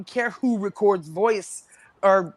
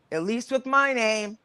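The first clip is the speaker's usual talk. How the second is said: normal talking voice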